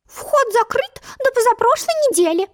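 very high, piping beak voice; squeaking creature. Acted. Long-beaked creature turning Alice away, high squeak. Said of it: "и пропищал".

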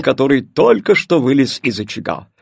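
ordinary delivery

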